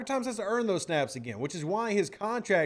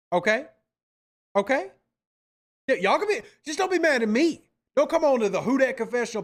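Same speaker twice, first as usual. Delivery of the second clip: fired-up plea; 5 semitones higher, 4 dB louder than usual. Real, not acted. Host pleads with chat.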